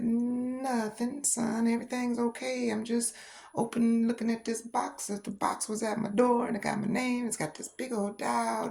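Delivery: high innocent voice. Retold or imitated. Mother answering son, voicing herself.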